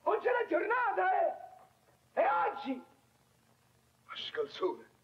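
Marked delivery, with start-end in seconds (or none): 0.0-2.7 shrieked much higher and louder, slow; father losing it over the day; furious outburst. Acted. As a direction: explode at the date.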